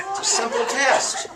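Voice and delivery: laughing. Real, not acted. Says it laughing.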